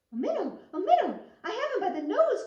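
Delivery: high, loud, delighted shout with a laugh; excited cry. Acted. Frog catches a minnow and shouts with glee. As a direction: crow with joy at the catch. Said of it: "cried Mister Jeremy Fisher".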